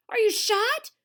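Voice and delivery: high, loud alarmed question; yelled. A narrator's reading. Tuna calls to dangling TV star, alarmed yell. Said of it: "Tuna yelled".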